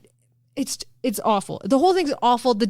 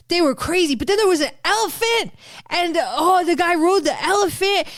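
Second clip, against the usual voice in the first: put-on higher, excited little-kid storytelling; toddler telling a story; 6 semitones higher than usual. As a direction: ramble breathlessly like a toddler recounting events. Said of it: "like a toddler telling a story".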